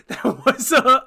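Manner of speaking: words through a laugh. Real, not acted. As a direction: laugh while saying it. Laughing at the mistake.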